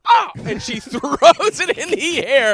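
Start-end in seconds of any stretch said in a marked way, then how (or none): 0.1-0.3 loud, high mock yelp; shocked yelp. Teacher zapped by the shock pen, loud mock yelp. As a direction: cry out as if zapped. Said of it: "just goes".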